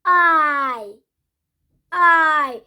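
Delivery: "I" gliding from O to I, loud; "I" voiced from the back of the throat; model pronunciation. Shown as a demonstration. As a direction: show the glide in "I". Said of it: "from the O to the I".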